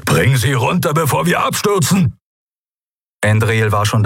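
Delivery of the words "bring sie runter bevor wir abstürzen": higher and shouting; terrified order to the pilot; panicked shout. Acted. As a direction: yell to bring it down before the crash. Kero in terror urging landing.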